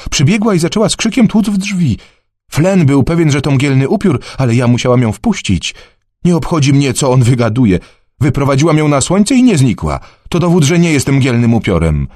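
high, eager voice of a worried mother; a village mother speaking up for her daughter; Jess's voice. Acted. Jess defends her daughter. (wysoki głos zatroskanej matki)

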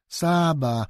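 put-on a high, loving child's call of the name; a girl fondly calling the dog; fond little girl. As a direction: coo the dog's name like a small girl.

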